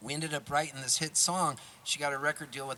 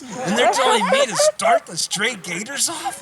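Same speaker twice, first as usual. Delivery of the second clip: much higher and louder, laughing in disbelief; amazed; 12 semitones higher, 10 dB louder than usual. Real, not acted. Musician recalls being asked to lead legends, excited and laughing. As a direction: let the disbelief burst out. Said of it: "I was just like this guy in the Rugburns".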